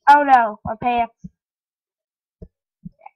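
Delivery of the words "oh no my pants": loud startled outburst. Real, not acted. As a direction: jump at the surprise. Startled by game mishap.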